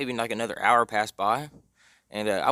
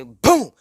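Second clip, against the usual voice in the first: explosive, high "boom"; sudden loud burst for a slap; loud smack imitation; 9 semitones higher, 14 dB louder than usual. Storyteller mimicking mom smacking her son, explosive.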